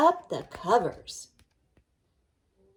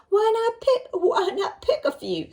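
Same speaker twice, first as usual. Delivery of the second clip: high coaxing wolf voice; sweet-talking wolf; sly wolf; 13 semitones higher, 8 dB louder than usual. Acted. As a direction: tempt her like a sly wolf. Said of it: "suggested the wolf".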